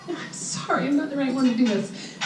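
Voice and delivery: breaking into a tearful near-whisper; fighting back real tears, barely voiced; apologizing to the crowd through tears, fighting them back; choked up. Real, not acted. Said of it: "Okay, I'm gonna start crying".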